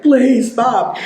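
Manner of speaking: put-on a scared kid's voice breaking as he begs; voice cracking. Kid in the back seat pleading with the driver, voice cracking high. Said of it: "so cracking in the voice".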